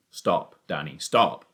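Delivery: low, urgent father's shout; shouted warning. A narrator's reading. Father halts son just before the pit, shouted warning. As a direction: warn low, loud on the last word. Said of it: "he shouted".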